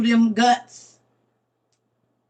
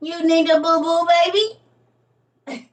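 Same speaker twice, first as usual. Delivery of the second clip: higher mock baby-talk tease; 7 semitones higher than usual. Teasing a limping friend in high baby talk. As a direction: coo it like to a toddler.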